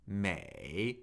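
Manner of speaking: "may" dipping low then rising; third tone. Shown as a demonstration. Teacher cycling the tones with a syllable, "may" dipping. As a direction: let "may" fall, then bring it back up. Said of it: "third tone".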